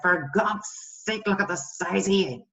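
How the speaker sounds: acted exasperated scolding of yourself; harsh self-talk. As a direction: tear into yourself, mildly disgusted. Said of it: "Note the tone, the change in tone".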